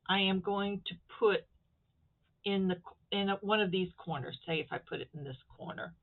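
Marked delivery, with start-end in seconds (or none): none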